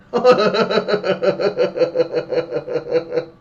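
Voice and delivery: big loud laugh, high and long; real laughing fit; loud laughter. Real, not acted. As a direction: crack up loudly. Burst of laughter.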